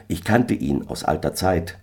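ordinary delivery

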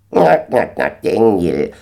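cackle, then honk the name; cackling gander. A narrator's reading. Gänserich schnattert.

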